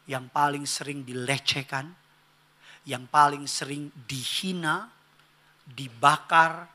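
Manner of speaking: usual speaking voice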